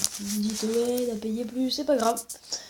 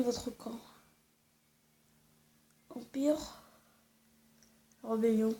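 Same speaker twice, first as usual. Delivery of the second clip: whispering; 9 dB quieter, slower than usual. Real, not acted. Reading game choice under breath.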